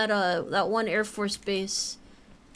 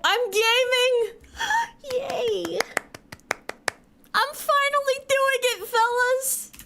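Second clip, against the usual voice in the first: high squealing joy at finally getting it; excited squeal; 13 semitones higher, slower than usual. Real, not acted. Streamer cheering his own climbing success, excited squeal. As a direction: squeal it like you just cracked the puzzle.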